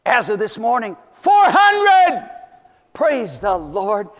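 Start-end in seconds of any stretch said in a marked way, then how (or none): 1.1-2.3 high and loud, a ringing cry; triumphant shout. Real, not acted. Preacher announcing the count of kits to his church. 2.9-4.1 slow praise. Real, not acted. Thanksgiving after the count.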